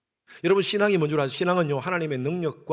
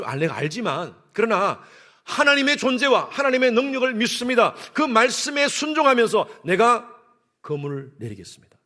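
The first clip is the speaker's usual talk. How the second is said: usual speaking voice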